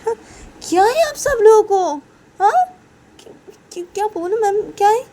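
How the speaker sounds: very high, loud outburst; hammed-up flustered annoyance; acted exasperation. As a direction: tell the whole family off, shrieking high. Sister exasperated at whole family.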